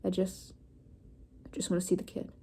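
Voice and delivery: soft, weary gruff rasp; tired gruff voice. Acted. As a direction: sound like a tired old dog. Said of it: "his gruff voice sounded like a tired old dog".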